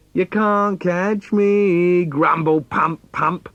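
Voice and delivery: put-on little boy dares his dad to chase him; teasing little boy. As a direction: tease like a cheeky toddler. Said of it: "my little boy trying to get me to play".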